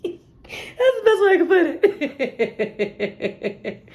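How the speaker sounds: high, breaking into laughter; laughing. Real, not acted. Reader cracking up at her own song, laughing. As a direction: laugh through the line.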